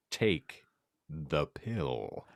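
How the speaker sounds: usual speaking voice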